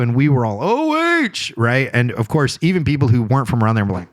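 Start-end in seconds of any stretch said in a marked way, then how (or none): 0.6-1.5 high-pitched, drawn-out rallying cry; mock crowd call-out. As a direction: imitate fans calling out in unison, pitched way up.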